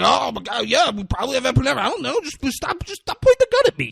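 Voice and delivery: high, fast, panicky squeal; hammed-up frightened nurse pleading; imitating a nurse terrified of a gun, high panic; scared nurse. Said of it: "Like, he's doing that".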